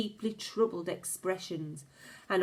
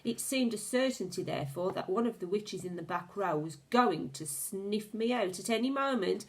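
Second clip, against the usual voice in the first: plain reading voice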